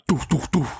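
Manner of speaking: mock door knocks. Imitating the man knocking.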